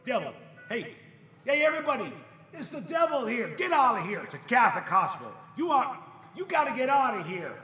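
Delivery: high, loud heckling; jeering shout. Acted. Hospital crowd to the devil, loud jeering shouts. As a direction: drive him out with shouts.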